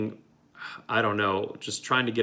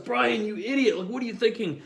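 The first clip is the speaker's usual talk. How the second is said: high, exasperated scolding; mock yell at friend; 11 semitones higher than usual. Driver scolding his friend, high and exasperated. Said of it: "I was yelling at my friend".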